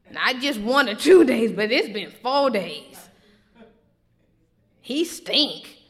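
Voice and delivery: loud, fast exasperated rebuke; exasperated Jesus. Acted. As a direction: scold her like a teacher out of patience. Jesus voiced answering doubting Martha.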